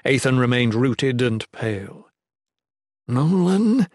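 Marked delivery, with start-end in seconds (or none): 3.1-3.9 raised pitch, holding panic down; barely controlled voice. A narrator's reading. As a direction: strain to keep control. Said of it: "voice barely controlled".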